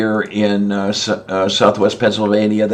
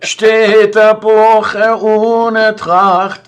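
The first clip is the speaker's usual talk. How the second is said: high, slow, hammed-up German verse; mock German recitation; 10 semitones higher, much slower than usual. Showing how German sounds to a friend, mock German recital. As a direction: recite it like an over-the-top German poem.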